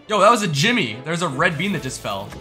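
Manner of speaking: ordinary delivery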